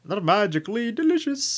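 high, soft hammed-up leprechaun. Joking about the cereal mascot, high leprechaun voice. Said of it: "Lucky Charms, uh, leprechaun".

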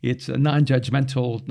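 ordinary talk, nothing marked

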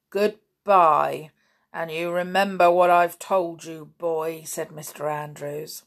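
a lightly voiced gruff old farmer, slow and ominous; old man's warning. Lightly voiced farmer warning the boy about spook trains, ominous. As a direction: lean in and warn them darkly.

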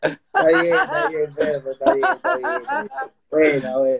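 talking through laughter; laughing. Real, not acted. Cohost reacting to the peluche story, laughing. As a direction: laugh as you agree.

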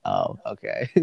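deadpan mom brushing it off; mom's low unbothered voice. Retold or imitated. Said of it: "She's like".

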